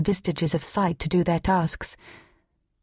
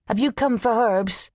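lightly raised questioning call; 3 semitones higher than usual. A narrator's reading. Old herbalist asks the arriving rider his business, calling out. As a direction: call a question over to the visitor. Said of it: "called Meta".

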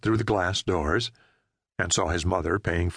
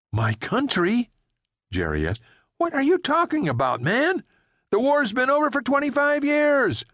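higher frightened driver demanding answers; alarmed Jerry. Acted. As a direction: press him, rising in alarm. Jerry disbelieving.

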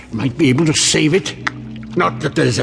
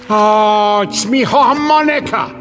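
high, groaning cry of dismay; dismayed wail; 8 semitones higher, 4 dB louder than usual. Acted. Janitor finding his soaked harmonica, dismayed wail. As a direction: wail over a ruined treasure.

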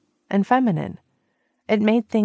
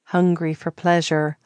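plain reading voice

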